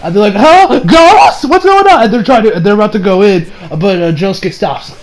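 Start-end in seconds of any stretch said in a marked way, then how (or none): 0.3-2.0 acted-out high, loud surprise; startled reaction. Retelling startled characters finding a ghost, high startled questions. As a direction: blurt it out as startled characters, pitch jumping.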